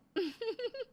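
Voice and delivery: short high brazen giggle; daughter-in-law laughing shamelessly in front of the aunt. Acted. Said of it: "बेशर्मों की तरह हंसती है".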